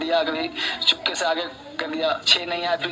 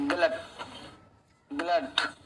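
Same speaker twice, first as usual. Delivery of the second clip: slow and gentle; 4 semitones lower, slower than usual. Shown as a demonstration. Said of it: "धीरे धीरे मेरे साथ बोलना, प्यार से, तेज आवाज में नहीं".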